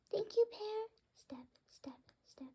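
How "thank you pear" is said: high bird voice. Acted. Bird voice answering.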